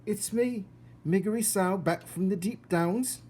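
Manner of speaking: put-on high, loud shout of a dim servant girl. Mig announcing herself to Cook, high and loud. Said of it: "she shouted".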